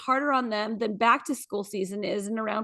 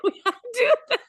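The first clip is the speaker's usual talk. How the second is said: high, laughing exclamation; giggling, delighted; laughing through words; 12 semitones higher, 5 dB louder, much faster than usual. Real, not acted. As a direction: burst out laughing as you say it. Laughing at the quote.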